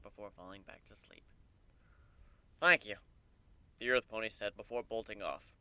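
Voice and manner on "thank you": relieved thanks. A narrator's reading.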